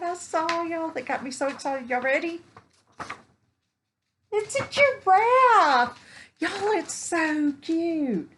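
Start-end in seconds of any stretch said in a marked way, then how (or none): 4.3-8.3 very high, loud and slow; unveiling a giraffe pattern to viewers, squealing; thrilled reveal. Real, not acted.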